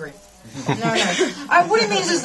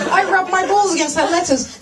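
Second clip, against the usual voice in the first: mocking vendor; 4 semitones higher than usual. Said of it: "And they're like".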